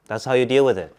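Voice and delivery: ordinary talk, nothing marked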